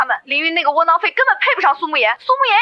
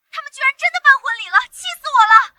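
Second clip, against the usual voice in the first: high, furious outburst; 气急败坏; 7 semitones higher than usual. Acted. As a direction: vent outrage down the phone. (气急败坏地高声抱怨)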